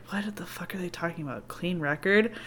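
hushed, whispered mock bafflement; baffled aside. Jacob puzzling over Edward and Bella's talk, whispered bafflement.

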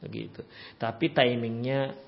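ordinary talk, nothing marked

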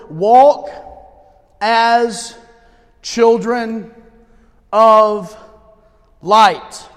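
each word loud, long pauses between; loud spaced proclamation. Acted.

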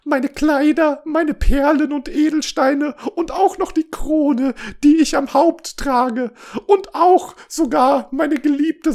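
very high, loud wailing princess; hammed-up sobbing. A narrator's reading. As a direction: plead desperately in mock sobs. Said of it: "und so schluchzte sie". (übertrieben schluchzend, sehr hoch)

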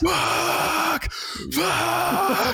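strangled high cry of frustration alone in the car; high-pitched frustrated cry. Retold or imitated. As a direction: squeal out the curse, half laughing. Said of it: "and you just go".

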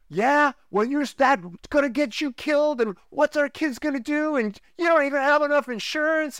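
high, put-on voice of a worried wife; imitating a wife's exasperated comeback; voicing the wife. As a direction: play the wife scolding and fretting.

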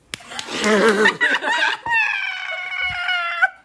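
high, loud shrieking cackle; a squealing burst of laughter. Real, not acted. Friend cracks up during math class, shrieking. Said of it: "What was that noise that just came out of you?".

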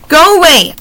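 mild narrated yell; woman yelling. A narrator's reading. Woman chasing ravens off with a mild yell. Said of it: "a woman yelled".

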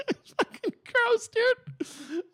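very high, slow, squeaky through laughter; real laughing revulsion; laughing disgust. Real, not acted. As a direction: squeal it out while cracking up.

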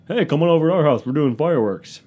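high, loud mock invitation from a lame neighbor. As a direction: ham up a cheesy host inviting you over. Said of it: "The lamest people do fireworks".

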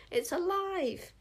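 high, slow cry of horror; horrified scream. A narrator's reading. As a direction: scream it out as if stung. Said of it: "screamed like he'd been stung".

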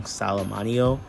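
ordinary delivery

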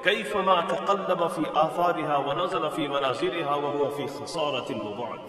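loud, projected reading of Arabic; Arabic text recitation. Acted. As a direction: read the Arabic out with force.

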